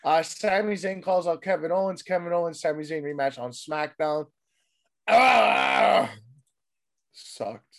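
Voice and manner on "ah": frustrated yell. Real, not acted. Frustrated outburst.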